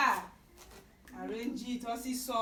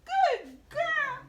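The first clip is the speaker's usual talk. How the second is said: high praise; 10 dB louder than usual. Real, not acted. Mother praising her toddler for saying beans, high and delighted. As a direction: cheer her on like a proud mom.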